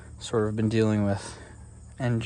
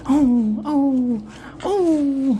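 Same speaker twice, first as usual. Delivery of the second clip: squeaky praising a dog; 7 dB louder, much slower than usual. Real, not acted. Cooing at the dog.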